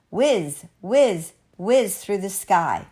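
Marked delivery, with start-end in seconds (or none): none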